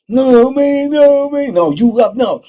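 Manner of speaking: panicked begging. Retold or imitated. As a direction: beg them to stop. Victim begs as attacked.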